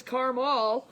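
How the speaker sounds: caramel as CAR-mawl, two syllables; viewer's odd caramel. Retold or imitated. Host echoing a viewer's vote, caramel as CAR-mawl. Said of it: "Tracy says caramel".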